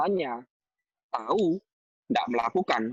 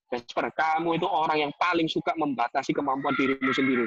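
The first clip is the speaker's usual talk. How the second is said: normal talking voice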